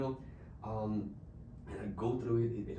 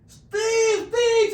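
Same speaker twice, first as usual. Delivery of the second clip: much higher, loud screaming of a name; 16 dB louder than usual. Retold or imitated. Old lady screaming for Steve across the complex, loud high scream. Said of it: "she started screaming".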